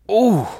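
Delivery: sudden high yelp of pain; pain cry. Acted. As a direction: cry out as the leg is moved. Wounded narrator feels pain.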